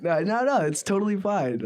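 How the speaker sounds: laughing. Real, not acted. Laughs off the host's warning.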